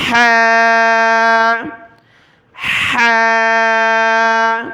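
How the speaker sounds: "ہا" with strong pressure in the throat, long and loud; cough-like push on the h of "ہا", then hold; throat-pressed demo. Shown as a demonstration. Teacher shows practice method, "ہا" pushed from throat. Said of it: "گلے پر زور دینا ہے".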